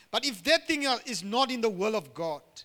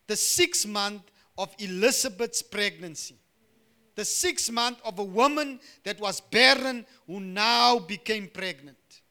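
ordinary talk

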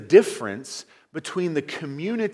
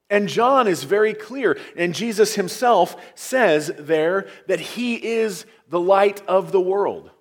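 a touch louder preaching, punching NOT; 3 semitones higher, 5 dB louder than usual. Acted. Preacher driving home his point, louder preaching. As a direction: preach it out to the congregation.

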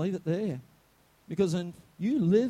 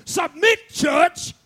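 loud, high barked command; mock heavenly yell at the church; 9 semitones higher, 9 dB louder, much slower than usual. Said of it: "Jesus never yelled out of heaven".

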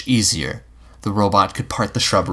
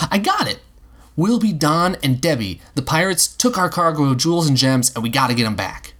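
much higher, bursting with an idea; boy setting up a make-believe pirate mission; excited boy; 7 semitones higher than usual. A narrator's reading. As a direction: announce the game like a thrilled kid. Will launches the pirate game.

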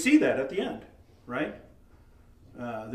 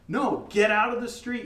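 toned-down demo of a warning yell; mild acted yell at a child; 6 semitones higher than usual. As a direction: raise the pitch in a firm parental order. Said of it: "You're gonna yell".

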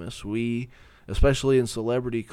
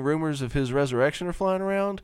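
1950s housewife; 5 semitones higher than usual. Pretend. Voicing the healed man.

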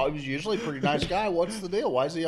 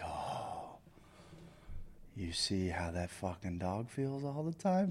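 much softer, slow whispered jab; whispered aside; 4 semitones lower, 10 dB quieter, slower than usual. Retold or imitated. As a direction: breathe it as a sly private dig. Muttered aside about the neighbor.

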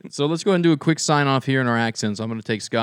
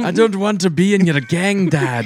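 loud, high hammed-up Scottish; Scottish accent; 4 semitones higher, 6 dB louder than usual. Son protesting to his dad, loud mock Scottish accent. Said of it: "It was a Scottish".

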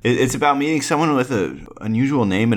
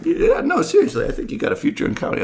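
laughing high praise; faster than usual. Pretend. [name] praising host.

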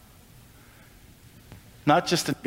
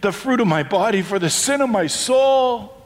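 lightly voiced people at their wits' end; a touch of mock exasperation; exasperated Israelites; 8 semitones higher, slower than usual. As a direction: sigh it out, slowing toward the end. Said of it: "throwing their hands up in the air".

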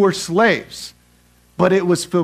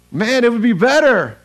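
loud, higher-pitched griping; grumbling complaint; 6 semitones higher, 5 dB louder than usual. Acted. Israelites grumbling against Moses and Aaron, complaining. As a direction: complain loudly, acting out the grumblers. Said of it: "Grumbling, complaining".

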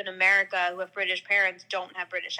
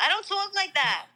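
put-on high, offended New York accent; hammed-up, higher-pitched Queens protest; mock Queens mom; 10 semitones higher than usual. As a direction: imitate mom's indignant Queens denial. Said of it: "my mom's, like, Queens accent".

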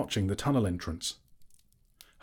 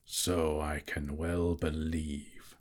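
slow deep dry dragon; 3 semitones lower, much slower than usual. A narrator's reading. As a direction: drawl it doubtfully. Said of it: "said Smaug".

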